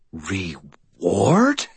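drawn-out, incredulous rising question; contempt and hate. A narrator's reading. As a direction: stretch the word with disgust. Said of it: "loading more contempt and hate".

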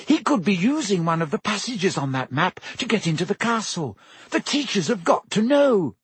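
high, urgent warning voice; urgent hiss. A narrator's reading. Hermione insists the teachers be told, urgent hiss. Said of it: "Hermione hissed".

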